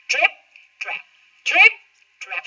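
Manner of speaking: middle goat footsteps. Acted. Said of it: "a sound like this".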